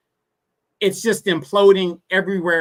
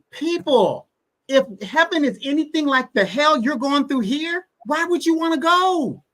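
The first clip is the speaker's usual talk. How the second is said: impassioned rhetorical plea; 8 semitones higher than usual. Real, not acted.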